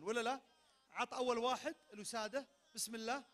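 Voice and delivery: usual speaking voice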